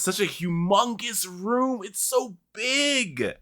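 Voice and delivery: high, soft strained voice, drawn out; exaggerated awe. Player complaining about a humongous room, high exaggerated wail. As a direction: stretch it out high and small.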